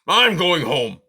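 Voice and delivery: loud, slow, high furious porcupine; furious shout. A narrator's reading. Aerith fed up with the dripping water, furious shout. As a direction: bellow it in a rage. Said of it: "Aerith shouted".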